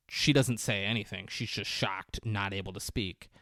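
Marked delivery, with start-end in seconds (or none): none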